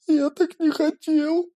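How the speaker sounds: high, slow, choked tearful voice. Acted. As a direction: speak through tears. Pyotr speaks while crying.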